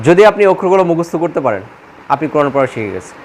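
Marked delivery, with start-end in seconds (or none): none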